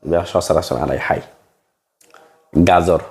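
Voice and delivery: ordinary talk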